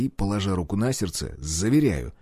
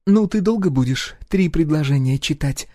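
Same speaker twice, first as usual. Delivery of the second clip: higher, louder exasperated wife voice; impatient woman snapping at husband; indignant wife; 5 semitones higher, 3 dB louder than usual. A narrator's reading. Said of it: "возмутилась Гюрза".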